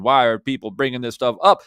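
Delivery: hammed-up mock of an emotional YouTuber; mocking whiny critic. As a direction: mimic an upset critic pulling his hair out. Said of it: "pulling your hair out on your videos saying".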